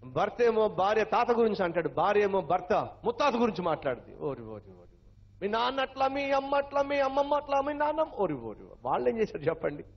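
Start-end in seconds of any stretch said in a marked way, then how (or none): 4.2-4.9 exasperated groan. Acted. Preacher groaning at the couple's blame game, exasperated. 5.4-8.1 higher, jeering blame; mock quarrel. Spouse taunting partner about their parents, mock jeering. Mocking spouse blaming relatives. 8.1-8.8 low, weary ori repeated; exasperated groan. Acted. Preacher groaning again at ancestors being blamed, exasperated.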